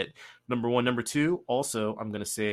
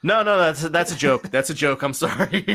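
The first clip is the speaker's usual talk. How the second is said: laughing; 6 semitones higher, 10 dB louder than usual. Real, not acted. Admits the fake name joke.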